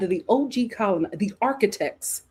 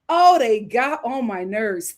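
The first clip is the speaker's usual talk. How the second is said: normal talking voice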